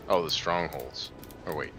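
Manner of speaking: ordinary delivery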